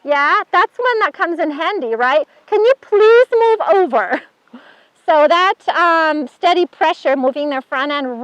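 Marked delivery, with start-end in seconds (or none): 2.5-4.2 higher, louder pleading ask; mock plea to a horse standing on you; pleading request. Owner begging the horse on her foot, higher and louder.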